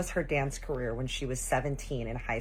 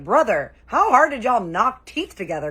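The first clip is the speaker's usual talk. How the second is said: loud, high, incredulous demand; exasperated scolding; 8 semitones higher, 11 dB louder than usual. Retold or imitated. Auntie scolding boy over chipped tooth, loud disbelief. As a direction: hit each word in disbelief.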